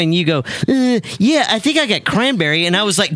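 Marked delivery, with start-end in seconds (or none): 0.7-2.7 quick, lightly raised take on Renee; mock Renee. [name] showing how Renee sounds, lightly higher and quick. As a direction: rattle it off as a chirpy Renee. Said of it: "This is how she sounds".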